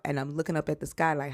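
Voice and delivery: usual speaking voice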